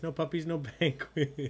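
breaking into laughter mid-phrase; laughing. Real, not acted. Host reading a player's reply, cracking up. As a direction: laugh through the last word.

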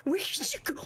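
high hushed whisper; eerie whispered calling; creepy whisper. Pretend. Whispered search, then normal take.

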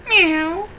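high, drawn meow; cat meow. A narrator's reading. Cat answering Duck, high meow. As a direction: imitate a cat's meow. Cat's sound in the story.

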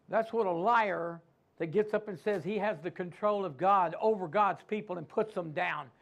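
normal talking voice

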